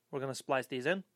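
ordinary delivery

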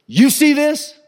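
high, forceful challenge; imitated angry father's challenge; confrontational dad. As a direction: thrust it at him as a demand.

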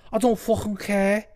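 put-on high-pitched Scouse accent trash talk; mock Scouse insults, high-voiced; Till. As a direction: imitate a riled Scouse fighter, pitched right up. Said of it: "Till's like".

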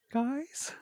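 high, timid call up into the dark; hesitant childlike call; scared little kid. Retold or imitated. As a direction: call out small and worried like a frightened boy. Scared kid calling from top bunk.

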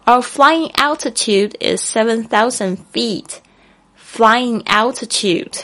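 slow teacher read; slow and clear. Shown as a demonstration. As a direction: read it out slowly for learners. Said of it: "这个高度是这样说的".

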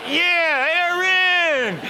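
very high, loud cheer of a greeting; exuberant yell. Retold or imitated. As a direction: holler the name as you pounce for a hug. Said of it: "I was like".

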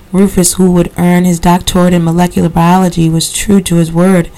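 usual speaking voice

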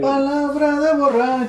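high, drawn-out mock chant; mocking echo. Listener jeering at a drunkard's promise, mocking. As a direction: stretch it out high to mock the vow. Mockingly echoes the quoted vow.

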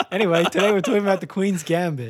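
host intro. Acted. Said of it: "topic intro".

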